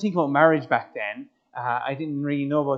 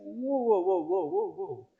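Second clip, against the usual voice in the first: high whoa, falling and softening; retold string of startled whoas; taken aback; 5 semitones higher, 4 dB quieter than usual. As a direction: put the brakes on mid-goodbye. Retold halting her at the train.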